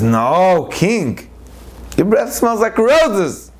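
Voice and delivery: high, slow, syrupy flattery; flattering wolf. Acted. Wolf flattering the lion king to avoid being eaten, fawning. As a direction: lay the praise on thick to save your skin.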